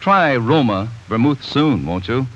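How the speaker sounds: normal talking voice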